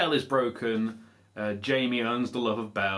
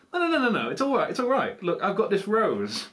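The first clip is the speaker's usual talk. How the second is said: high-pitched, insistent protest; hasty reassurance; 9 semitones higher, 3 dB louder than usual. Acted. Old woman pleading with the prince at his door, insistent. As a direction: plead your case in a raised pitch.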